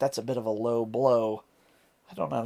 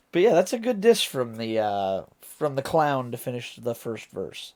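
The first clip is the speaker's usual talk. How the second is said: normal talking voice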